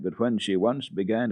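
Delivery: ordinary delivery, nothing marked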